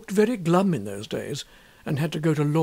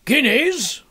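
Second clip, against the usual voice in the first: high, loud, airy artist's pronouncement; breezy painter; 6 semitones higher, 4 dB louder than usual. Acted. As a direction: toss it off as an obvious truth. Trevor explaining his fee.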